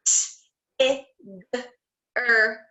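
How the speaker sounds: "T, I, g, er" said as separate sounds; sounded out letter by letter. Shown as a demonstration. Teacher sounding out Tigger for the class, one sound at a time. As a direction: pull Tigger apart into its sounds. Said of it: "let's do Tigger".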